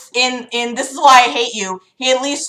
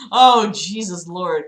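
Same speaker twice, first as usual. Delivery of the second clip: ordinary delivery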